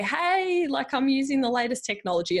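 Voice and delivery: high, sing-out "hey" of a show-off; mock boasting. Mimics people showing off technology.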